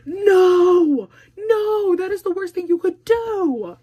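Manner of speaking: high, loud, crying-out no; emphatic no. Acted. Warning a friend against texting her ex, with a loud high no. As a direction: shut the idea down hard.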